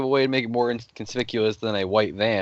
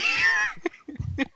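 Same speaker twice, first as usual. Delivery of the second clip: high shrieking burst of laughter. Real, not acted. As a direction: squeal with laughter. Reacting to bad roll.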